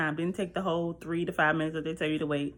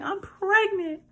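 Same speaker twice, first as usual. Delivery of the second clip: high, louder, tearful blurt; bawling confession; 13 semitones higher, 5 dB louder, slower than usual. Retold or imitated. Confessing the pregnancy to her husband, tearfully. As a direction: sob it out in a high voice. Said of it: "Y'all, I bawl".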